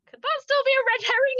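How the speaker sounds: high, loud, rushed hopeful plea; hopeful question. Retold or imitated. Viewer asking mum during the show, high hopeful question. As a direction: pipe up quickly, grasping at hope.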